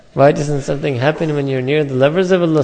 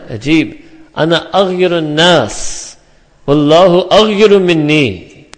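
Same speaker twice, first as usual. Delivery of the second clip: solemn recitation; 3 semitones higher, 7 dB louder, slower than usual. Acted. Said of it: "Nabi Kareem Sallallahu Alaihi Wasallam said".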